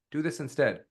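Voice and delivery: no emphasis on "this"; all one tone. Shown as a demonstration. Coach shows the wrong way, "this" left unstressed. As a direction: run "Do this instead" evenly, unstressed. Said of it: "Notice how that all stayed the same tone?".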